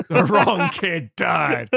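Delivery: loud, slow, hammed-up lament; mock-dramatic outburst with laughter creeping in; over-the-top accusation. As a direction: draw each word out, loudly. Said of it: "doing the whole literal phrase".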